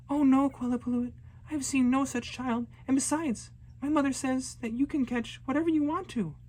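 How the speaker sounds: put-on high, eager little girl sweet-talking; nervous flattering girl. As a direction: charm the monsters to escape.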